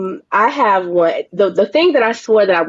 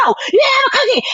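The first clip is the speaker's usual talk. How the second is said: much higher, half-crying mock shout; retold yell. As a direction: snap at the kid after a bad day. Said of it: "And I was like".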